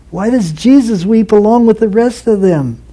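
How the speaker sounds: ordinary talk, nothing marked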